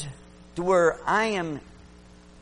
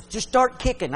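urging call; 8 semitones higher, 6 dB louder than usual. Retold or imitated. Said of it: "Brother Duncan says".

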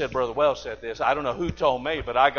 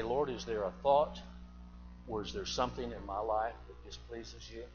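quiet prayer; 5 semitones lower, 13 dB quieter than usual. Retold or imitated. Morning prayer quoted.

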